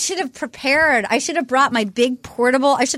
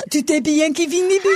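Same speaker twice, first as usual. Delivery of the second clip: French with American accent; 2 semitones higher than usual. Shown as a demonstration. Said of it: "I wanna try to speak in French to her".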